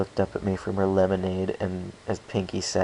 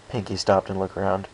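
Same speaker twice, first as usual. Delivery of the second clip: usual speaking voice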